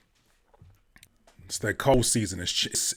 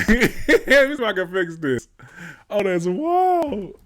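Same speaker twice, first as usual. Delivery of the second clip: laughing; 9 semitones higher, 7 dB louder, slower than usual. Real, not acted. Hears his own recording.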